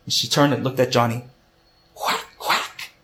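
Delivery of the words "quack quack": sly, taunting whisper; wicked whisper. Acted. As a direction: tease him in a wicked hush. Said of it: "she said with a wicked smile".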